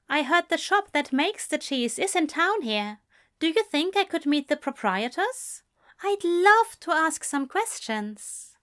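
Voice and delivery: Aziraphale's voice. A narrator's reading. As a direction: pitch it up and press on eagerly.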